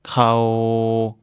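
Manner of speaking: "Krao" held level and long; Thai word. Shown as a demonstration. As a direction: sustain "Krao". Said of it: "Elle est vivante".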